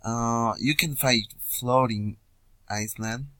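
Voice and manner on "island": ordinary talk, nothing marked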